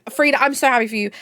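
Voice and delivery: normal talking voice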